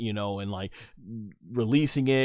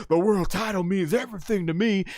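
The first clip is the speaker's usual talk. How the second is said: high-pitched imitated impassioned promo; Stone Cold; 8 semitones higher than usual. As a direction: plead it like a hurt wrestler, hammed up. Acts wrestler's impassioned promo.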